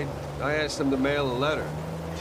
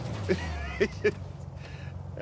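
high, breathless chuckle; long, pleased laugh trailing away; laughing at own joke; 6 semitones higher, 4 dB quieter than usual. Acted. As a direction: crack up at your own punchline.

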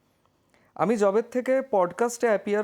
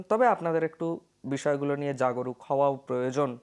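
usual speaking voice

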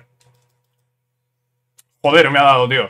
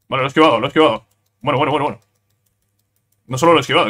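normal talking voice

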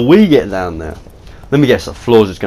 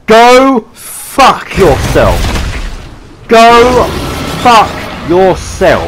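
furious yell; 9 semitones higher, 5 dB louder, much slower than usual. Real, not acted. Yelling at game enemy.

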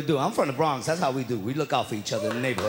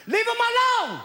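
mock yell. Retelling a neighbor yelling at police to leave him alone. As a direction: shout at the cop to let him go.

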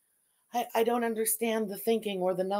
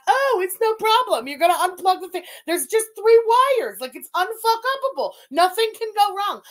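mocking know-it-all; 7 semitones higher, 7 dB louder than usual. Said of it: "someone in life tells you".

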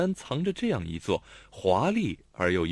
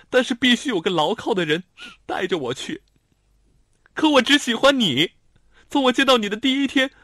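put-on higher girl's voice, building to a loud cry; explain, then burst out with love; pleading young woman; 9 semitones higher, 7 dB louder than usual. A narrator's reading. Lanlan confesses to Xiao Tong, building into a loud plea.

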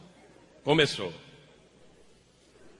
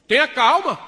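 louder, higher "calm down"; loud stalling plea; 6 semitones higher, 9 dB louder than usual. Acted. Husband stalling his angry wife, loud plea. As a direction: bark it to buy time.

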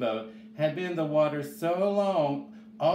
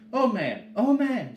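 put-on high, loud child's call; high-pitched urgent shout; 9 semitones higher, 5 dB louder than usual. A narrator's reading. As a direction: call out urgently to rouse someone. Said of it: "shouted Chang".

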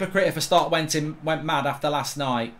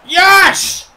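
very loud, high goal cry; triumphant shout; 12 semitones higher, 14 dB louder than usual. Real, not acted. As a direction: roar it out in celebration. Pogba scores.

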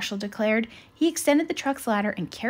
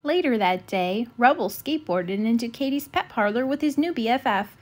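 plain reading voice

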